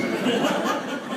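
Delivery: unvoiced burst of laughter; breathy laugh. Real, not acted. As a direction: laugh at your own joke. Laugh after punchline.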